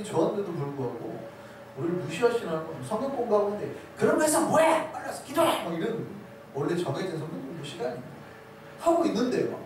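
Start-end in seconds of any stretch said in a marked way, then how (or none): 4.0-5.6 high-pitched mock yell of a pastor; shouting pastor. Pastor interrupting a Bible study to demand prayer, shouting. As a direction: imitate a pastor barging in with orders. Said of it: "소리치고 막".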